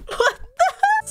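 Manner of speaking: very high choked splutter; choking. Real, not acted. Player startled by what popped out, choking. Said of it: "Sorry, I just literally choked".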